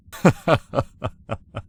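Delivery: high, loud burst of laughter; high chuckle that tapers off; amused laugh. Acted. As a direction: laugh out in a descending run. Amused reaction in acted scene.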